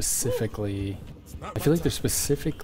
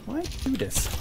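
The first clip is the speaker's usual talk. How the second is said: high whiny voice; 3 dB quieter than usual. Acted. Exasperated high-pitched complaint.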